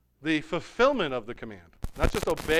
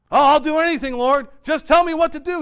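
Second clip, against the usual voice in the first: eager pleading; 11 semitones higher, 11 dB louder than usual. Acted. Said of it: "he says".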